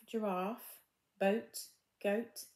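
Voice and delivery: plain reading voice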